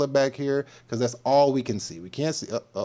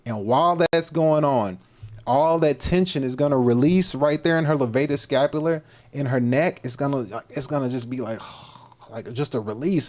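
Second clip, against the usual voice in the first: usual speaking voice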